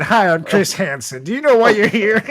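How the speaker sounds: doing Chris Hansen through giggles; a laughing take on the TV host; Chris Hansen impression. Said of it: "Before you do this segment".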